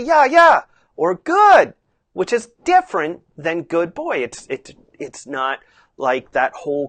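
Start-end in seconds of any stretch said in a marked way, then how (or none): 0.0-1.7 high, loud verbal reward; bright, lifted praise that keeps him working. Shown as a demonstration. Rider praising horse mid-work, bright tone. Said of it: "bright tone".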